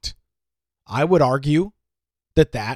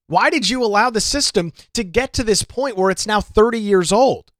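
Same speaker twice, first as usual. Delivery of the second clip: pitched-up, pointed demand; challenging question; 6 semitones higher than usual. Retold or imitated. Putting the meeting's question to the commissioner, challenging. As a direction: press him hard for an answer, voice raised in pitch. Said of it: "the question was".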